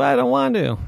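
put-on high whining refusal of a little girl; whiny child. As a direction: whine it like a stubborn kid.

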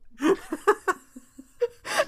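high, loud laughter near tears; can't stop laughing at himself; helpless laughter. Real, not acted. Host laughing at his own Scottish attempt, high and loud.

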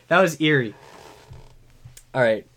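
ordinary delivery

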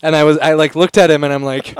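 ordinary talk

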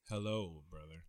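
low, soft, slowly dropped impression; Damon. Friend shows off a TV vampire impression, hushed Damon voice. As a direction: murmur it low, letting the last word sink away. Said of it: "do [name]'s impression real quick".

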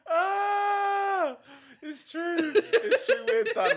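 high-pitched laughing cry; laughing hard. Real, not acted. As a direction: laugh it out loud.

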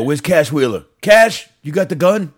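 loud, high call across the room. Pretend. Podcaster calling out to Cash Wheeler, loud and high. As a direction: shout for Cash like he's in back.

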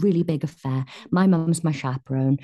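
ordinary talk

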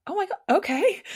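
high, loud starstruck reaction; acted amazement. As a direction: play up being taken aback. Acts out stunned reaction to name.

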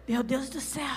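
soft, feeble mock-suffering lament; sick man groaning. Preacher mimicking a man with flu, weak pitiful groan. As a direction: sigh it out as if dying of a cold. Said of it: "falando que tá com gripe assim".

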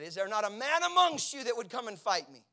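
loud, high giant's taunt; taunting shout. Retold or imitated. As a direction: bellow a challenge at cowards. Goliath taunting the army.

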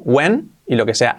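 ordinary talk

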